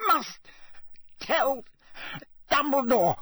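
very high, halting words; each word a terrible effort; broken man forcing out words; strained effort. A narrator's reading. Crouch straining to speak.

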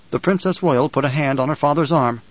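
ordinary delivery, nothing marked

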